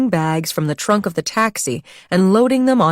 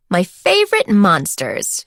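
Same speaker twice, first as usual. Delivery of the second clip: exclaiming; 2 semitones higher, slower than usual. A narrator's reading. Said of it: "she cried".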